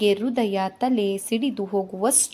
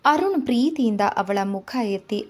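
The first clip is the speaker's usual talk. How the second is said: ordinary delivery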